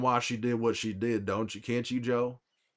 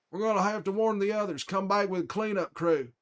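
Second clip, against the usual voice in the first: higher, quicker brotherly voice, alert and urgent; Tommy impression; 8 semitones higher than usual. Imitating his brother Tommy for chat, urgent and higher. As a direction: sound like his younger brother giving orders. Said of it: "do an impression of my brother".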